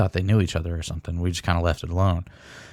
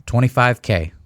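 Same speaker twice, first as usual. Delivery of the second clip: taunting; 4 semitones higher, 4 dB louder than usual. Retold or imitated. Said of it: "Bob was over there, like".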